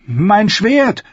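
high, loud desperate cry. A narrator's reading. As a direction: shout for your sword in panic. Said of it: "rief sie".

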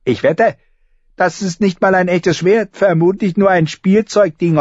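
put-on high, sneering boy's voice; taunting boy. Orphan boy mocking Arya's sword, high and sneering. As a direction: jeer at her like a cocky kid.

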